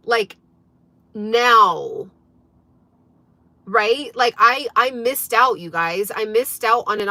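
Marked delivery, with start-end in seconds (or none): 1.1-2.1 "now" drawn out and punched louder; stress on "now". Shown as a demonstration. Coach showing viewers why timing matters, emphatic "now". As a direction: lean hard on a long, loud "now". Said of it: "I'm emphasizing the word now".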